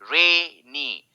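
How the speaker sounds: Rainy slow, with long रे then नी; stressed A sound. Shown as a demonstration. Teacher showing the A sound, rainy drawn out. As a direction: hold the ए in rainy. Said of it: "ए sound".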